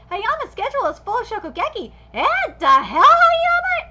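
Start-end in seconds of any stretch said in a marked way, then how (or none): 2.1-3.9 sharp, loud, rising "Hayama?" in disbelief; shocked disbelief. Acted. Reading a rival's shocked line aloud, in loud disbelief. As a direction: spit out the name as if caught off guard.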